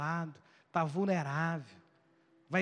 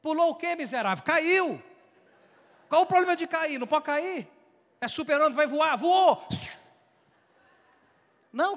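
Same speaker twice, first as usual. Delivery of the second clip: loud mocking retort; 8 semitones higher, 6 dB louder than usual. Real, not acted. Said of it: "mocking the fussing".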